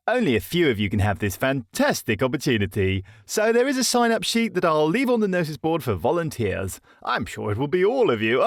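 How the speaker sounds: upbeat officer addressing troops; jolly, falsely cheerful announcement; hearty officer voice. A narrator's reading. Major Percy voiced again.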